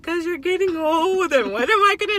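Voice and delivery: hammed-up distress at losing a parent; anguished outburst. Voices anguished thoughts about mom dying.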